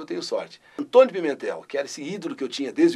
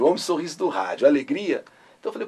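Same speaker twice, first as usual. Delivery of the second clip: normal talking voice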